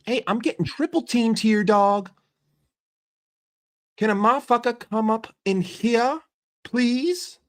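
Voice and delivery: high-pitched, indignant player begging for backup; imitated exasperated plea. Triple-teamed player calling teammates over for help, mock exasperated. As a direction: act out a beleaguered player, rising on "please".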